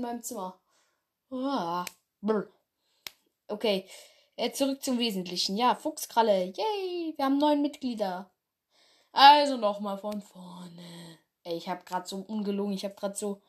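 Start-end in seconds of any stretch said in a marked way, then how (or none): none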